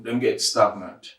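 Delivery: ordinary delivery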